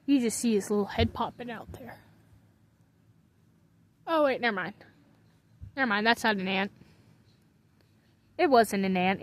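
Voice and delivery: normal talking voice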